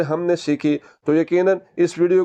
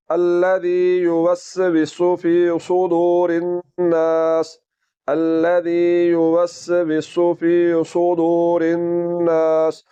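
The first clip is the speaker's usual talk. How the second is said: slow, even sustained chant; Quran recitation; much slower than usual. Shown as a demonstration. Teacher reciting the full ayat for students, slow chanted recitation. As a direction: recite with long vowels and held nasal n. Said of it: "पूरी आयत किस तरह होगी".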